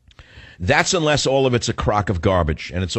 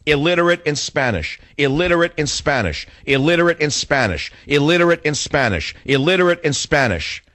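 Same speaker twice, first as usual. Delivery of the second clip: "Illiterate in Spanish" hammered in identical falling tunes; emphatic repetition; 3 semitones higher than usual. Real, not acted. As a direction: drum "Illiterate in Spanish" in, each repeat equally emphatic. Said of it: "And I emphasize that".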